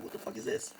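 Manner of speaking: high, whispered what the fuck; stunned disbelief. Retold or imitated. Narrator reacting to a stranger taking the stage, hushed shock. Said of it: "I'm like".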